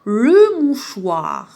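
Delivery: "Le mouchoir" drawn out very slowly, syllables joined; slow syllable blending. Shown as a demonstration. Teacher modelling the blended word for pupils, very slow. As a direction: linger on each syllable of "mouchoir". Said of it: "ربطت بيناتهم".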